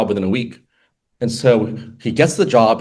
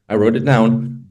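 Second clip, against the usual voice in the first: ordinary talk, nothing marked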